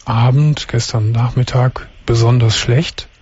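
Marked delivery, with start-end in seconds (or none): none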